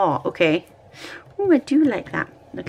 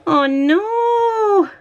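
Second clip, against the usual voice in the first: high, loud wail of dismay; dismayed cry; 13 semitones higher, 4 dB louder than usual. Real, not acted. Vlogger watching her washi tape ruin, high dismayed wail. Washi sticker goes wrong.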